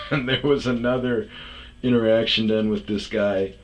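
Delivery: usual speaking voice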